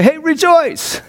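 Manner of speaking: bright high call of rejoice; cheery Paul. Retold or imitated. Paul in prison calling out, high and cheery.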